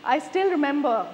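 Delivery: usual speaking voice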